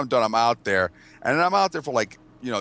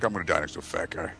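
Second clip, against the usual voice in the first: grumbled resignation; 6 semitones lower, 7 dB quieter than usual. Retold or imitated. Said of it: "He's like".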